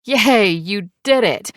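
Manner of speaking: excited yay for the new parents; cheering. A narrator's reading. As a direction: celebrate them, slow and loud. Cheering while clapping for couple.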